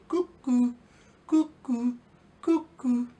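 high sing-song cuckoo call; cuckoo bird call. Pretend. Teacher imitating the cuckoo clock bird, high and sing-song. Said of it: "the cuckoo will come out".

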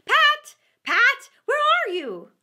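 very high, very loud furious yell; angry rabbit. A narrator's reading. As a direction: shriek for the servant in a rage. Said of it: "Next came an angry voice, the rabbit's".

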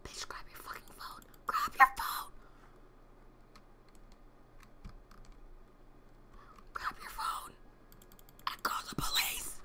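breathless scared whisper; frightened whisper. Real, not acted. Streamer hiding in the dark cafe, whispering in fear. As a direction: hush it like something might hear you.

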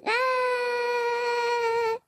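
a strangled pained cry; pained yelp. Acted. Cop just tased himself, short pained cry. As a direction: yelp as the shock hits.

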